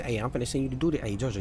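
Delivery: usual speaking voice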